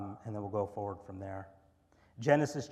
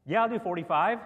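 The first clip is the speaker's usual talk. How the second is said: eager agreement; 7 semitones higher, 11 dB louder than usual. Acted.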